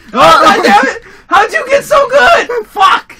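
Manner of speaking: high, loud anguished yell; frustrated shout. Real, not acted. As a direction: scream in laughing frustration. Losing to friend in game.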